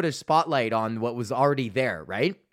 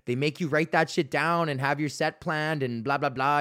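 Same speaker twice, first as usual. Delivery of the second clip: ordinary talk, nothing marked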